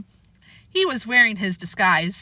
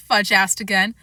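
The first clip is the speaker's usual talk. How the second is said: plain reading voice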